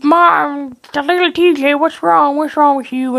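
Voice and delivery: high, mock-concerned voice; fussing onlooker. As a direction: imitate someone fussing over a crying child. Voices onlookers fussing over crying child.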